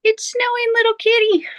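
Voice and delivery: high sing-song pet voice. Real, not acted. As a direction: coo it to the kitty. Talking to her cat.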